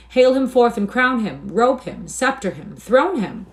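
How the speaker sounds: loud, high jeering cries; crowd cries. A narrator's reading. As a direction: call each command out separately. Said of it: "These and twenty other cries broke out".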